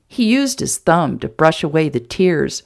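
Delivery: plain reading voice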